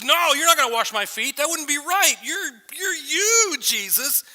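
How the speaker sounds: high, loud acted protest; emphatic refusal. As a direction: refuse outright, appalled at the very idea. Peter refuses the washing.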